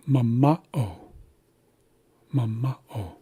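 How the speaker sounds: "mama'o" stressed on the middle "ma"; second-syllable stress. Shown as a demonstration. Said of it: "we're placing emphasis".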